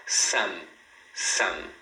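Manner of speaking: SOME ending with lips shut on M; closed-mouth M. Shown as a demonstration. Teacher explains final M to students, closed-mouth M. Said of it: "você deve fechar a boca".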